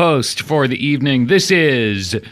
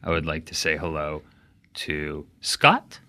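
deadpan mock-formal shout-outs, lower and softer; mock solemn announcer; 11 semitones lower, 10 dB quieter than usual. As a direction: greet everyone like a grave dignitary. Formal mock greetings continue.

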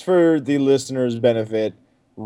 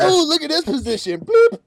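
mocking porn sharer; 13 semitones higher than usual. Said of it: "It's like".

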